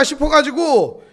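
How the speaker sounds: ordinary talk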